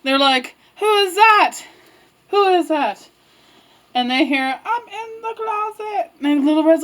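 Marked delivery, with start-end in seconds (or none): 0.8-3.1 raised, wondering voice; startled question. Acted. Red and carpenter hearing a knock, startled. 4.6-6.1 high, small, faraway cry; muffled grandma calling. Acted. Grandma calling from inside the closet, muffled.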